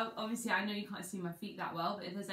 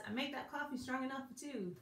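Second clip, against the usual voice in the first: a light mock American accent, a bit higher; 5 semitones higher, 5 dB quieter than usual. Teacher teasing Jess with a lightly mock American accent. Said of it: "That's my American accent".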